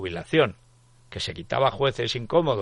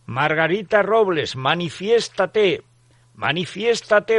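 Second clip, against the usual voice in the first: higher, loud, slow séance summons; voice from beyond the grave; 7 semitones higher, 8 dB louder, slower than usual. Pretend. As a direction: call out like a spirit medium. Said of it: "voz de ultratumba". (voz de ultratumba de médium)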